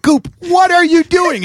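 high, loud and slow disbelief; alarmed. Retold or imitated. Calling to the drunk friend, high loud alarm. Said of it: "And I'm like".

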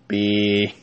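low, droning robotic beep; dying robot beep. Acted. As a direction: let the beep die out like a broken robot. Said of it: "I sounded like a dying robot".

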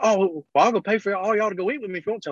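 lightly voiced clingy fan, quick and high; imitating the creeper. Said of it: "He was like".

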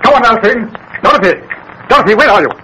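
high urgent call; husband calling for his fainted wife. Acted. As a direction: shout her name through the bungalow.

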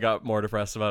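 usual speaking voice

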